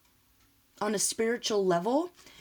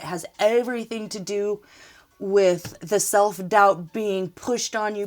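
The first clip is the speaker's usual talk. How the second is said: ordinary delivery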